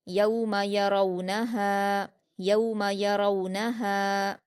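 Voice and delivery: यौम यरौनहा slowly, smooth au glides, long final हा; soft waw glide. Shown as a demonstration. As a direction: glide gently through यौ and रौ, hold हा in यौम यरौनहा. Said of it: "दो हरकत खींचकर पढ़ेंगे".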